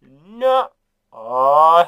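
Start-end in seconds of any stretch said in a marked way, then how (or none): 0.0-0.7 loud, high, slow retort; loud mock rebuttal. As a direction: shout it down dismissively. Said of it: "And it's like".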